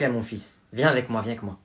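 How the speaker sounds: ordinary delivery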